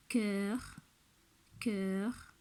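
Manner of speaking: "cœur" said twice, slow and clear; "cœur" modelled twice with an open vowel; repeat for the class. Shown as a demonstration. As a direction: model "cœur" for children to echo. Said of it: "Pour les mots qui contiennent une syllabe".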